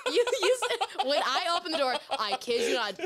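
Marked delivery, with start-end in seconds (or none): none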